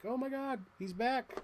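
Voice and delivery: high, startled gasp of a line; mock shock. Toy fan mock shocked at the toy's return. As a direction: act mock-startled that he's back. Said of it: "It's like".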